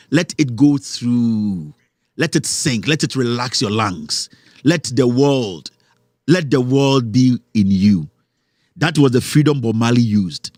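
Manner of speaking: slow, low, hushed meditation voice, lingering on every word; guided meditation. Acted. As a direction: draw them into calm like a guru leading a breath. Slow meditative breath guidance.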